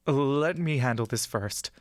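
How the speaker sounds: ordinary delivery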